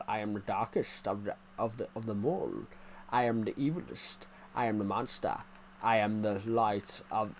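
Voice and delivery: low, ominous villain proclamation; evil twin. Pretend. Playing his own evil twin.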